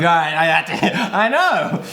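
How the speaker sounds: laughing through the words; giggling while admitting it. Real, not acted. Caught out by the [name] joke, laughing. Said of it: "Were you gonna say [name]?".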